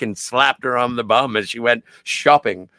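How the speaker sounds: ordinary talk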